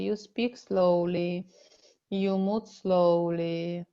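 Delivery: slow and unhurried, each word drawn out; slow seductive speech. Shown as a demonstration. Slow, lingering delivery. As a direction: show how slowly a woman speaks to seduce a man, slowly. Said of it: "try when you want to seduce to be more slowly".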